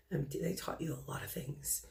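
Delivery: whispered aside. Real, not acted. Medium relaying spirit message softly.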